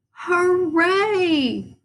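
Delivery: high excited cheer. A narrator's reading. Fans cheering for Froggalina, high and excited. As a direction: cheer for your candidate. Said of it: "cheered Froggalina's fans".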